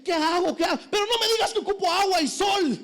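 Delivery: high hammed-up plant voice; panicked plant. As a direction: beg the gardener in panic. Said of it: "le dice".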